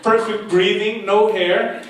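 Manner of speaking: ordinary delivery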